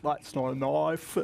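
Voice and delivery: slow, mouth-wide mock Aussie accent as a foreigner does it; foreigner's Aussie accent. Australian driver mocking foreigners' overdone Aussie accent, slow and wide. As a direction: stretch the Aussie vowels open like an outsider trying too hard. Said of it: "when foreigners try to do an Aussie accent, I notice they really, like, open their mouth a lot".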